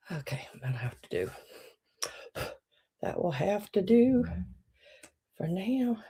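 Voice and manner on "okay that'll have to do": soft whisper, falling at the end; mumbled resignation to self; whispered aside. Real, not acted. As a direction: settle for it under your breath. Muttering to self.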